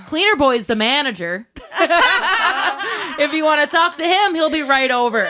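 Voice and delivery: high, loud, laughing smugness; triumphant mock-helpful reply; gleeful comeback. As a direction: relish the retort, barely holding back laughter. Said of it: "And I'm like".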